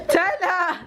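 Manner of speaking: loud high laughing cry of his name; startled call. Real, not acted. Surprised call at child.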